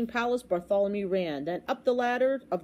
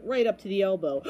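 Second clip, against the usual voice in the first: ordinary delivery, nothing marked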